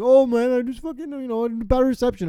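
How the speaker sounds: high, loud, dopey excuse voice; mock excuse. Mocking the lost friend's excuse, hammed-up dopey voice. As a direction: mimic the friend's lame excuse.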